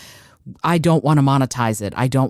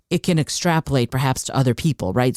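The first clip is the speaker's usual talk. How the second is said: ordinary talk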